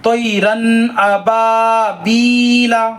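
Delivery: very slow तैरन अबाबीला, full ला, no nasal; correct recitation. Shown as a demonstration. Teacher showing correct tanween, slow and clear. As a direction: draw out तैरन अबाबीला clearly without ghunna. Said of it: "नाक में आवाज़ को नहीं ले जाना है".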